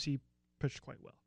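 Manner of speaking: normal talking voice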